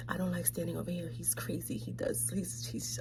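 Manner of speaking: unvoiced retold whisper. Aunt whispering her fear into his ear. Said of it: "whispers in my ear".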